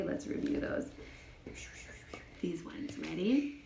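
whispered, dropping soft; hushed. Real, not acted. Teacher whispering to a child before counting. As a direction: breathe it as a secret.

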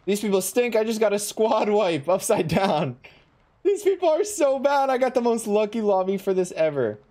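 high, loud trash talk; gloating excitement. Real, not acted. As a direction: crow about the win. Gloating after squad wipe.

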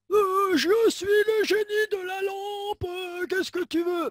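high, slow, booming genie of the lamp. Acted. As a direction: play the genie granting a wish, big and grand. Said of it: "une lampe à huile à frotter".

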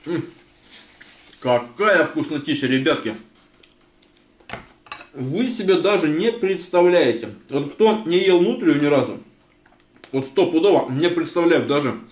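eating and speaking; talking while chewing. Real, not acted. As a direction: mouth partly full. Seen 1: Meal throughout; bites bread at 3.8, keeps eating around speech.

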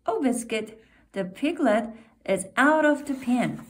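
usual speaking voice

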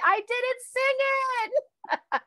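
high, drawn-out laughing admission; laughing at oneself. Real, not acted. Laughs at own slip.

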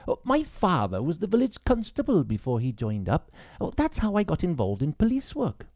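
put-on young woman's voice. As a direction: bite back the questions politely. Grace explains.

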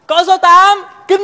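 excited shout. Acted. Disciple shouts bingo win.

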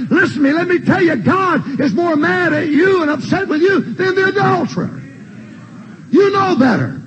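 high, pressing rebuke from the pulpit; fiery preaching. Acted. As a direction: drive it home to the sinners. Preacher rebuking congregation at full force.

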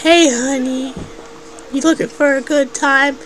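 flirty hooker voice. Pretend. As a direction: lure a customer in, teasing.

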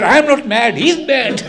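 high, loud mock shout of a furious father; indignant protest. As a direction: shout it back, half laughing. Tied-up father protests to neighbours.

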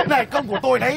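laughing, high-pitched boast; proud laughter. Acted. Matchmaker boasts to a neighbour of the match, laughing proudly. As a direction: chuckle while claiming the credit.

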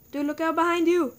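loud urgent warning yell; alarm shout. Real, not acted. Warning friend in Minecraft.

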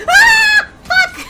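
very high, very loud shriek and curse; piercing jump-scare outburst; real startled scream. Real, not acted. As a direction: scream and swear in shock.

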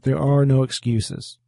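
ordinary delivery

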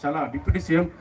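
normal talking voice

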